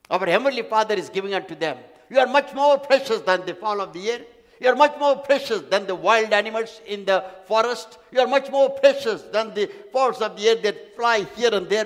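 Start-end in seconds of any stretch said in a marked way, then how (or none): 2.1-11.8 rising, louder preaching repetition; preaching build. Acted. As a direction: drive each repeat harder. Preacher repeating rhetorical build.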